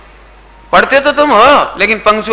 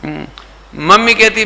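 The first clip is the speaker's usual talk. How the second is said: ordinary delivery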